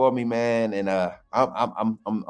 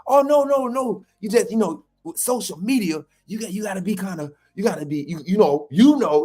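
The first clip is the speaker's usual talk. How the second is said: Jamarcus Shepherd voice; 8 semitones higher than usual. Retold or imitated. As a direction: imitate the coach brushing it off. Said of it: "this my Jamarcus Shepherd voice".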